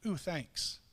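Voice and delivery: flat, soft, barely interested; a lukewarm ooh, thanks, underselling it; unenthused thanks. Retold or imitated. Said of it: "I get it, and I'm like".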